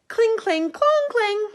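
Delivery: high, loud metal clang sounds; clashing swords. Acted. The knights' swords clashing in battle, high loud clangs.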